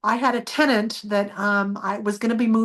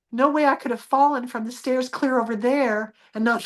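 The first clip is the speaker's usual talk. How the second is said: normal talking voice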